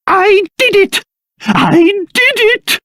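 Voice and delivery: high-pitched gleeful exclaiming; jubilant. Acted. King finally got the alchemy pot working, jubilant. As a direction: crow with triumph.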